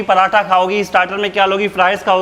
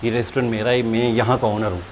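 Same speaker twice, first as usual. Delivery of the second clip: suddenly soft and lower, overly courteous; mock polite; 6 semitones lower, 8 dB quieter than usual. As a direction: play the humble gentleman, hands folded. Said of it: "नाम बताया, हाथ बांधे, बैठ गया". (नकली अदब से, धीमी नरम आवाज़ में)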